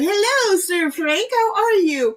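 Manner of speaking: high, sing-song hello to a pet; pet greeting. Real, not acted. Owner greeting her pet Sir Frank in a high sing-song. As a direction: coo a welcome at the animal.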